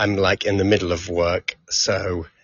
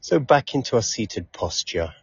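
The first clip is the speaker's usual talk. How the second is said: unhurried meditation guide, long pauses between phrases; calm, measured relaxation cues with lingering silences; guided meditation. Acted. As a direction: ease them through a body scan, leaving space after each phrase.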